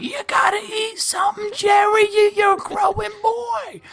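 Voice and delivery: very high, loud falsetto mom; hammed-up mother nagging her son. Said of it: "his mom's like".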